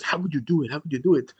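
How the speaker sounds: ordinary talk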